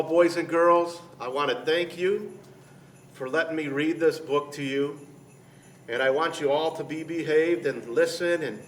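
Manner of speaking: usual speaking voice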